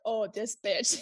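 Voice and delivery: joking. Retold or imitated. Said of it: "I was like".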